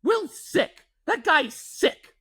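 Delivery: high, mock-exasperated old man; father-in-law's grumble. Father-in-law complaining to his wife about the friend, high mock outrage. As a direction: sputter in disbelief at the friend. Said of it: "he goes".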